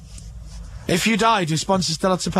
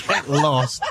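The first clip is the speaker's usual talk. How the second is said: laughing; 7 semitones lower than usual. Real, not acted. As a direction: get lost said through a laugh. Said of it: "second take laughing".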